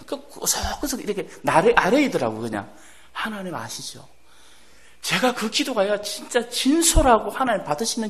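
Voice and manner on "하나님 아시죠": quiet plea. Retold or imitated. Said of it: "내 귀에 들리게".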